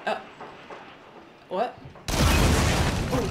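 ordinary delivery

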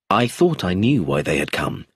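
plain reading voice

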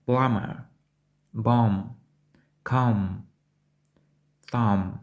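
"Plumber. Bomb. Comb. Thumb." with every B after M silent; repeat-after-me model. Shown as a demonstration. Teacher has learners repeat after them, silent B. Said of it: "Повторіть за мною".

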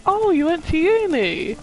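high-pitched innocent astonishment; retold surprise. Justifying the remark to Katie, retold naive surprise. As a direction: act out finding out, pitch swooping up. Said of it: "I didn't know she went to uni".

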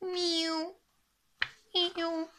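high thin meows; high mewing cat; cat meowing. Acted. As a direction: meow like a little cat. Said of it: "un chat qui toute la journée miaulait".

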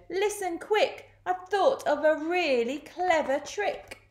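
high, urgent ladybird voice; eager ladybird. A narrator's reading. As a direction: share a plan in a high, excited voice. Ladybird urgently proposes a trick.

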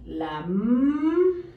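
M said as a long hummed "mmm"; slow "la", then a sustained M hum; letter sound M. Shown as a demonstration. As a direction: draw M out as a humming sound. Sounds out letter being written.

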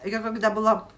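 talking while chewing food; muffled by mouthful of food; mouth full, chewing. Real, not acted. Seen 2: Bites before, cheeks full and chewing until ~5s, then talks clearly.

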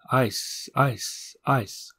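the tunnels echo his last word back; faint echo. Acted. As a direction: let each word die away down the tunnel.

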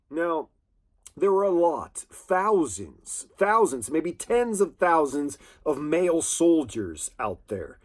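ordinary delivery

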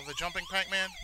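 ordinary talk, nothing marked

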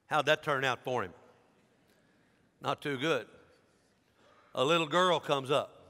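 usual speaking voice

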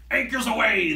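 loud, high pirate call; rallying pirate cry; captain's cry. Acted. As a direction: bellow it from the deck. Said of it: "the captain cries".

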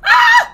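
very high, loud shriek; sustained high "ah"; startled scream. Real, not acted. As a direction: scream out in alarm. Attacked during the game.

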